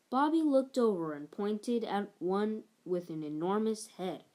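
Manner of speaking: plain reading voice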